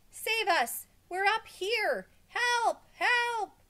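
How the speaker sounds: very high, loud screaming; screamed cries for help. A narrator's reading. Help monitor screams out of the window for rescue, high-pitched screaming. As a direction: yell frantically to be rescued. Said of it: "he screamed".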